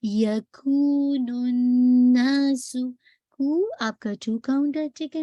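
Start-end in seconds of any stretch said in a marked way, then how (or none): 0.0-2.8 یکون drawn out two counts; slow melodic recitation; two-count stretch. Shown as a demonstration. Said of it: "Almost two count اس کو stretch کیجئے".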